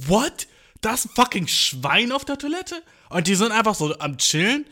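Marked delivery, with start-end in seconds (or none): 0.1-2.8 shocked disbelief. Retold or imitated. Speaker hearing there's a pig in the airport toilet, shocked. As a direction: blurt it out stunned.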